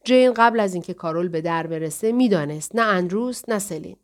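ordinary delivery, nothing marked

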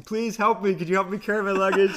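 high, loud desperate begging; frantic plea. Retold or imitated. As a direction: plead frantically while running. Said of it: "I'm asking".

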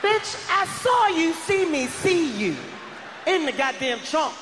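high, slowed, drawn-out angry shout; furious yell. Retold or imitated. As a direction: yell the accusation at her, stretching every word. Yelling at girlfriend over trunk.

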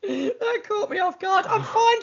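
slow words through laughter; laughing. Real, not acted. Host recovering from the guest's joke, still laughing. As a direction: speak while still laughing.

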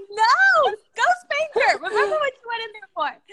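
put-on high, loud kids' shouting; hammed-up indignant shout; mock outraged protest. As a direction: yell it like siblings demanding justice. Siblings yelling protest at dad.

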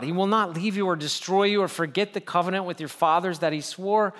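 ordinary talk

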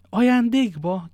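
high, loud, drawn-out astonished question; exclaiming. A narrator's reading. D'Artagnan reacting to Porthos's horse gift, exclaiming. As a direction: cry it out in disbelief, stretching the word. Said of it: "kiáltott fel D'Artagnan".

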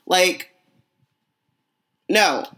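ordinary delivery

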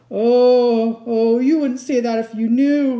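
loud, high wail of guilt; between sobs. A narrator's reading. Fawn protesting Lucy's kindness, sobbing. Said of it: "between his sobs".

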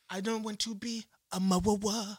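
exaggerated Barbadian accent impression; mocked Barbadian accent. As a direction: imitate a thick Barbadian accent, garbling the words. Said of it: "her accent was".

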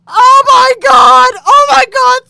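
high, drawn-out full scream; acting out an ecstatic scream. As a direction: scream it out at the top of your voice.

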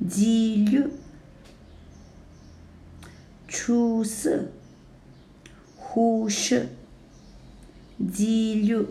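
出色 high level, then a sharp falling tone; first plus fourth tone. Shown as a demonstration. Teacher modelling 出色 for learners, high then falling tone. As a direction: drop 出色 hard on the second syllable. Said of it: "And now first and third tone".

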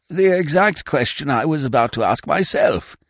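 put-on high, fluttery voice of a vague old lord; a dithering aristocrat caught off guard, pitched high; flustered old earl. Vague old earl startled by his secretary, high and flustered.

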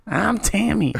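slow, high falsetto persona voice; high put-on voice. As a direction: drawl a goofy high character voice.

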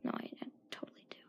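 fast unvoiced whisper; whispered confession. Real, not acted. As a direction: mumble the admission under breath. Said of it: "I don't curse".

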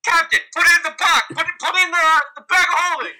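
excited yell. Retold or imitated. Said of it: "oh, I'm gonna be like".

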